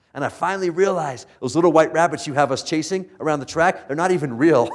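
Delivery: mildly worked-up acted quote; impassioned realisation. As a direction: build to the punch line, voicing the dog. Dog's big realisation in story.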